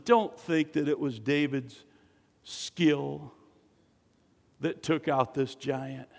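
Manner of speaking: ordinary delivery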